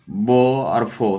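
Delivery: बो and फो said very slowly and deliberately; teacher slowly modelling the lip-teeth sounds; slow sound drill. Shown as a demonstration. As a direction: draw out बो and फो for the class. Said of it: "दंतिया, निचला होंठ से".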